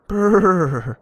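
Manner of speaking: high, loud mock shiver sound; shivering brrr. A narrator's reading. Baby Flamingo shivering on the cold mountain. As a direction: shiver through the lips. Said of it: "said Baby Flamingo".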